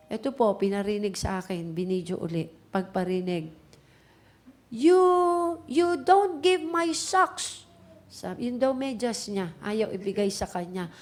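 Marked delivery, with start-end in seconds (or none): 4.7-7.6 put-on high, drawn-out angry kid's yell; mock tantrum shout, slow and high-pitched; imitated child's shouting. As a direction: mimic a little kid hollering in a quarrel. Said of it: "sigaw siya ng sigaw at the top of his voice".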